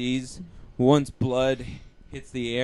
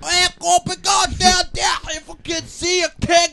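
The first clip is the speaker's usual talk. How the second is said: bad Australian accent; 13 semitones higher, 6 dB louder than usual. Friend performs his bad Australian surfer voice. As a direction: ham up a terrible Australian surfer. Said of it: "Anthony voice".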